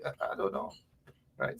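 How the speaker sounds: breathy aside under the breath; hushed doubtful aside; whisper. Real, not acted. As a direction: whisper it to yourself. Unvoiced aside.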